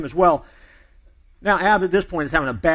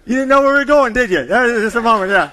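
teasing call-out; 6 semitones higher, 10 dB louder than usual. Real, not acted. Pastor teases congregation after punchline.

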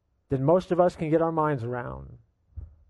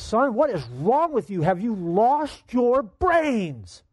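father exploding; 6 semitones higher, much slower than usual. Retold or imitated. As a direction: yell at the son in disbelief. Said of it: "his father exploded".

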